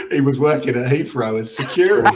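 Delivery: normal talking voice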